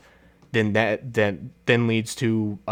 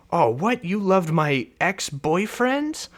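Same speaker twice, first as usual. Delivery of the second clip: higher, slowed mock shock; shocked sister; 7 semitones higher, slower than usual. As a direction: drag out the betrayed disbelief. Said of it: "she goes".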